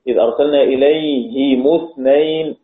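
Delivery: إليهم اثنين as "ilayhimu-thnayn"; kasra then damma. Shown as a demonstration. Teacher recites the other readers' way, إليهم as "ilayhimu", kasra then damma. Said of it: "قراءة الباقون".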